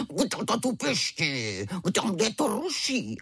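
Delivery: slow, drawn-out growly roar; strange tiger roar. A narrator's reading. Tiger cub woken by squeaking, strange roar. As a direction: roar it out like your tiger father. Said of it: "zařval divné jako tatínek".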